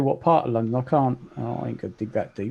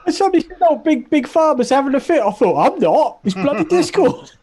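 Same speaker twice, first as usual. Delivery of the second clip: excited retelling; 12 semitones higher, 6 dB louder than usual. Real, not acted.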